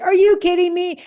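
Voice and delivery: high-pitched mock indignation; imitated outraged teen. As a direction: imitate a teen's incredulous protest. Outraged teen son retold.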